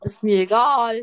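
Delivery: sharp loud comeback; loud retort. Real, not acted. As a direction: snap it back loudly. Annoyed retort to friend.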